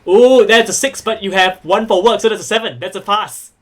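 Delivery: loud, thrilled game master; punchy, excited verdict; excited announcement. Real, not acted. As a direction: call out the result with a big opening "oh". Game master announces a successful roll.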